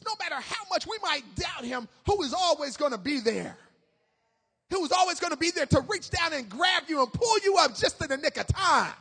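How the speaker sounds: impassioned preacher in full flow; fervent preaching. Real, not acted. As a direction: build the sermon in loud rhythmic waves.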